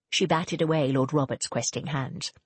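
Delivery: plain reading voice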